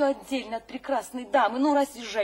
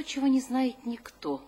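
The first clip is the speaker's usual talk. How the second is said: normal talking voice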